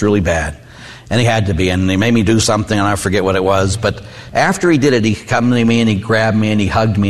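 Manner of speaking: ordinary talk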